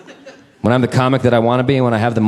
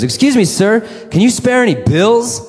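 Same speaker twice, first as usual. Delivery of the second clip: homeless woman asking; 12 semitones higher than usual. Retold or imitated. Homeless woman to a passer-by, high polite plea. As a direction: ask a passer-by sweetly for money. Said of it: "she goes".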